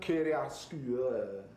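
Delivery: "Chiaroscuro" drawn out slowly, syllable by syllable; careful pronunciation attempt. Shown as a demonstration. Presenter tries copying the owner, careful slow pronunciation. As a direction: sound out "Chiaroscuro" slowly, learning it. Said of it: "is that how you say it".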